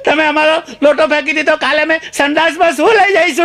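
put-on very high bawling child's voice; mock wailing. Pupil lamenting the thrown-out pot, hammed-up wail. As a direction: cry the complaint out like an aggrieved little boy. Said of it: "શું કામ રડે છે?".

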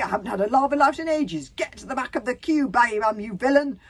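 loud, high, quarrelsome shout; rowdy, indignant bellow; roar. A narrator's reading. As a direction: roar at someone pushing in. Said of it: "roared Toughnut Senior".

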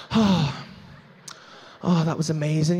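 low, hushed and breathless; breathless woman. Retold or imitated. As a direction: sigh it out, spent. Woman breathless after climax.

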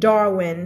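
"Darwin" with the R rolled back towards the throat; curled R in DARWIN. Shown as a demonstration. Teacher modelling for students, "Darwin" with curled R. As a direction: curl the tongue back on the R of "Darwin". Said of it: "เสียง R มันจะม้วนๆ".

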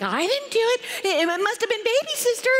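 put-on high, quick child's denial; protesting older sister. Older sister denies and blames.

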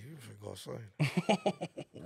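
low, slow, soft mutter; retold grumbled comeback; muttered retort. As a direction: drag it out under your breath. Retold low sibling back-and-forth.

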